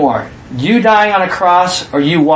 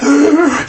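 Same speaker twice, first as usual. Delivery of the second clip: mock gasp; 9 semitones higher than usual. Mock reaction to the thief.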